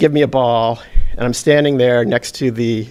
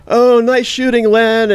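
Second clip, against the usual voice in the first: higher, louder, slow mock praise; sarcastic jeer; 9 semitones higher, 4 dB louder, slower than usual. Fans heckling Len on his way back, sarcastic jeer. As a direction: jeer him with fake praise. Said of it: "everybody's, like, going".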